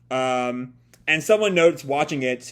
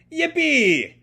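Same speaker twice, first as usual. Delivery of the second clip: much higher, bright "yippee"; gleeful cheer; 11 semitones higher than usual. Acted. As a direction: cheer like a kid who found a way out. Ellie spots the tractor.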